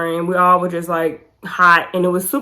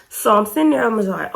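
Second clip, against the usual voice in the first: usual speaking voice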